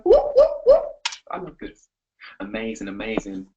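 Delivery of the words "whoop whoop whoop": very high, loud whoops; cheering whoops. Real, not acted. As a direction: whoop her on like a crowd. Cheering her growing confidence.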